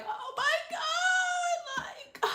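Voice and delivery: high, slow, wailing cry of "oh my God"; mock crying wail. Reliving the sad movie for viewers, high crying wail. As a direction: wail it out like you're sobbing at the movie. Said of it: "I was like".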